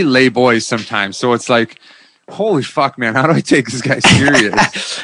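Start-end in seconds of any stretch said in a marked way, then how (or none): 2.3-5.0 loud, high, amused outburst; exasperated disbelief. Retold or imitated. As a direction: exclaim in mock exasperation. Retold amused disbelief at the coach.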